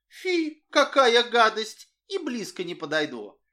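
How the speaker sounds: high, loud squeal of disgust. A narrator's reading. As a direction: wrinkle your nose at it. Girl disgusted by manure.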